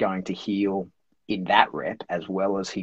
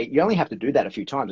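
ordinary talk

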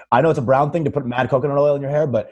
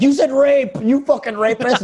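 high, mock-outraged accusing cry; hammed-up imitation of an accuser; outraged accusation; 12 semitones higher than usual. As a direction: hurl it like an indignant misreader. Imitating people who misread and accuse.

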